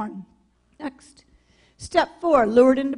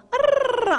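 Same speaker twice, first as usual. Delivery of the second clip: excited growl; 12 semitones higher than usual. Retold or imitated. Excited about Mexico job.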